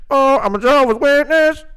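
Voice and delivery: high, loud put-on voice of a caller; mock caller voice. Host mocking a caller who wants a disguised voice, high put-on voice.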